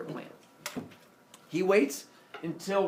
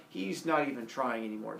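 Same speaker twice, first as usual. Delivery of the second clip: ordinary delivery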